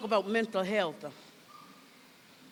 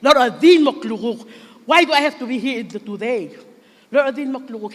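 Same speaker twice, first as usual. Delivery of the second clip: louder, mock-weary plea to the Lord; weary complaint to God; 8 dB louder than usual. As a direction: lament like a tired soul on a bad day. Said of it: "you're just gonna be like".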